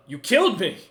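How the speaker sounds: high, loud creature accusing; agitated accusation. Acted. Game master voices revived creature confronting Bargis, agitated accusation.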